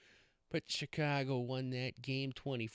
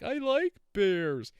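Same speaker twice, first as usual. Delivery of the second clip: put-on high, loud, slow pouting child voice; mad little kid; 10 semitones higher, 11 dB louder, much slower than usual. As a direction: imitate a five-year-old stamping his foot. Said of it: "And he got all mad".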